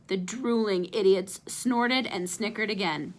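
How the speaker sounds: plain reading voice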